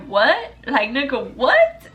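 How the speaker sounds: stunned double-take; incredulous. Retold or imitated. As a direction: react in disbelief, the last word shooting up. Acted disbelieving reaction to friend.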